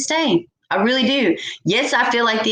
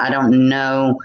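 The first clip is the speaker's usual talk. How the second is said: normal talking voice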